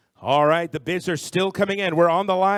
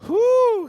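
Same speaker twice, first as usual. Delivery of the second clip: excited high whoo; high whoop; 7 dB louder than usual. Real, not acted. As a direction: whoop with delight. Favorite item announced.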